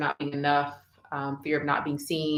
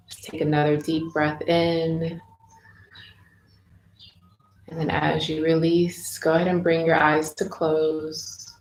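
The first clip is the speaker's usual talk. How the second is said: guided meditation. Real, not acted. Host leading listeners into inner child healing, guided meditation voice. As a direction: ease them into stillness, phrase by unhurried phrase.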